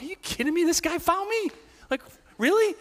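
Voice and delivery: high, disbelieving outburst; can't believe he found me; incredulous. Retold or imitated. Pastor hiding in dark room, high disbelief. Surprised pastor seeing someone approach.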